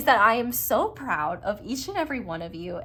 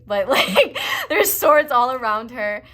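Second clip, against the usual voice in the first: laughing; 5 semitones higher, 11 dB louder than usual. Real, not acted. Giggling at kinky tarot card.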